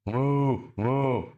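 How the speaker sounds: two held, level moos; imitation cow moo. Cow cries out to God in distress, imitated moo. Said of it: "I'm a cow".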